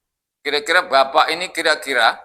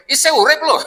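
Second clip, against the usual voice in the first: higher, louder Javanese blurt with a laugh; East Javanese greeting; 10 semitones higher, 4 dB louder than usual. Retold or imitated. Preacher mimicking an East Javanese greeting, blunt and laughing. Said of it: "kalau bahasa Jawanya".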